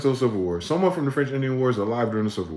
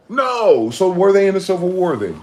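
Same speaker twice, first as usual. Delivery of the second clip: incredulous outburst; 9 semitones higher, 8 dB louder than usual. Real, not acted. Ranting at a listener.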